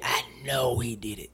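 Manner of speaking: unvoiced conspiratorial whisper; hushed aside to the listener. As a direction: lean in and whisper it knowingly. Whispered repeat of accusation.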